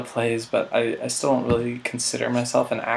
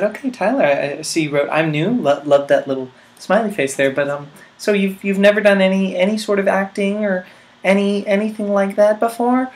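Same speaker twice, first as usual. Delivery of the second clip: mimicking casting director; 8 semitones higher than usual. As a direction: ask it sweetly like the casting lady.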